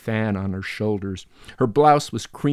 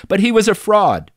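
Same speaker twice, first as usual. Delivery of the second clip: heated outburst; 12 semitones higher, 10 dB louder than usual. Acted. Heated argument about Titian.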